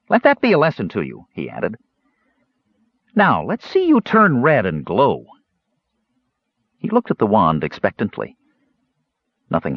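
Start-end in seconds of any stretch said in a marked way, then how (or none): 0.1-1.3 light kender scolding, pitched up; a cheeky kender lecturing a creature. A narrator's reading. Kender Earwig scolding the groggy creature, light and high. 3.2-5.3 slow, expectant kender voice, pitched up; a curious kender waiting for magic; eager kender. A narrator's reading. Kender Earwig coaxing the wand, slow and eager.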